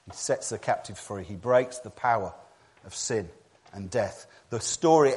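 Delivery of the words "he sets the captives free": ordinary talk, nothing marked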